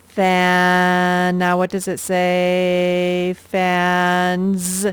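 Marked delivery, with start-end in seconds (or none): 0.1-1.4 "Fan" loud and held long on vowel and n; stretched sounding-out. Shown as a demonstration. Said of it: "this verb says fan". 3.4-4.9 "Fans" loud and stretched, ending in a buzzing zzz. Shown as a demonstration. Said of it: "the ending S spells Z".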